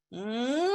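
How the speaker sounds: long rising "hmm"; drawn-out hum sliding up toward a yes; considering hum. Shown as a demonstration. Student acts out a tentative yes, rising hmm. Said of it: "Or if it's like".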